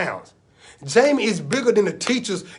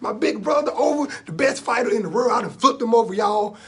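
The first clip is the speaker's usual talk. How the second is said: excited yelling; 4 semitones higher than usual. Acted. Boy tells everybody he flipped his brother, yelling. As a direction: yell it out to everyone, starting highest. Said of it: "I'm yelling at everybody".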